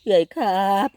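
high, sweet put-on wheedling voice; cute pleading voice. Begging sister to like and share, high cute voice. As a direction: coax big sister like a little kid.

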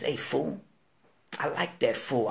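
low, soft, understated remark; quiet approval. Retold or imitated. Danger tells friend he likes Wicked, quiet approval. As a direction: say it low and matter-of-fact.

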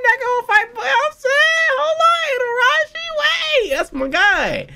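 loud, high-pitched excited yelling; frenzied fan screaming. Real, not acted. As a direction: scream over your own words in hype. Overexcited cheering for favourite character.

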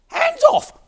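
sudden high, loud squawk; talking papaya. Acted. Papaya warns off the farmer picking it, high shout.

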